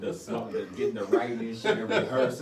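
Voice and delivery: loud, high, laughing through the words; laughing while talking. Real, not acted. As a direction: crack up mid-sentence. Cracks up telling smoking story.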